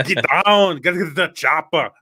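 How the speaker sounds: hammed-up action-movie Austrian; Arnold Schwarzenegger. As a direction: shout it like an Austrian action hero. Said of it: "vaguely like Arnold Schwarzenegger".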